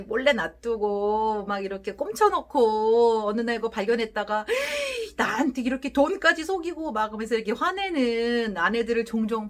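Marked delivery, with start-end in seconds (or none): 4.5-5.1 sharp high gasp of discovery; a startled intake of breath, very high; shocked gasp. Acted. Wife discovers hidden money.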